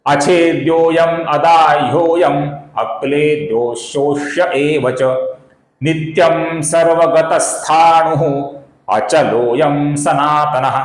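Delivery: very slow, measured Sanskrit recitation; slow verse recitation. Shown as a demonstration. Teacher reciting the Gita verse to viewers. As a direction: intone each word slowly.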